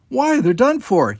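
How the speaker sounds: high surprised why; puzzled. A narrator's reading. Jana questioning the captain's order, high surprised. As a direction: question the odd target.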